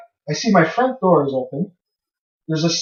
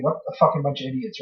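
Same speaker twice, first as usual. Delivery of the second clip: imagined mocking listener; 4 semitones lower, 8 dB quieter, faster than usual. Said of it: "probably saying".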